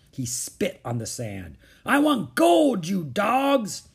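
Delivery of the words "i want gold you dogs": high, loud, slow demand; angry pirate captain. Acted. Captain Bones to his men, angry pirate demand. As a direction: bellow at a useless crew.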